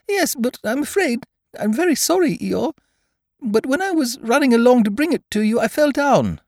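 very high, squeaky little creature voice; small anxious animal confessing in a high pitch; apologetic Piglet. A narrator's reading. Piglet confessing to Eeyore that he fell, apologetic.

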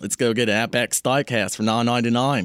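put-on playful Australian accent, outback style. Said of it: "Would you briefly favor us with that?".

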